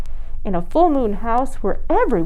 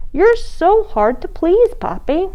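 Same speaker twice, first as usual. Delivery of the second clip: put-on exasperated child; 9 semitones higher than usual. Katie exasperated with Poppy.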